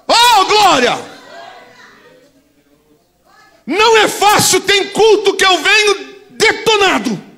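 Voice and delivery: high, loud fiery preaching; preaching at full force. Real, not acted. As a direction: thunder it out at the congregation.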